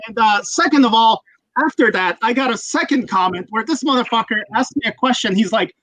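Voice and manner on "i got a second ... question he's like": ordinary talk, nothing marked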